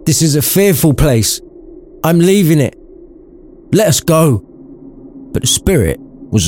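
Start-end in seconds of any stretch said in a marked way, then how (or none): 0.1-4.4 put-on high, urgent old man's voice; frightened Scrooge. A narrator's reading. Scrooge begs the spirit to leave, high and frightened. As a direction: plead to leave the fearful place. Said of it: "cried Scrooge".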